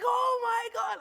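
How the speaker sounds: high, loud "oh my God"; relieved dad shout. Retold or imitated. Dad seeing his daughter safe, loud outburst. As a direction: burst out like a shaken father. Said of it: "He was like".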